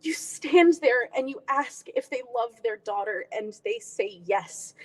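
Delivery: intimate, heartfelt audition monologue with long pauses; a daughter confronting her mom, measured and pained; dramatic monologue. Acted. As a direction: play it as a wounded daughter.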